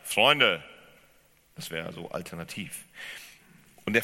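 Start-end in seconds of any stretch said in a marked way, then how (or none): 0.0-0.6 laut, kumpelhaft gerufen; lockerer Zuruf. Retold or imitated. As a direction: ruf die Männer locker an. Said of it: "Er könnte auch sagen". (lauter kumpelhafter Ruf)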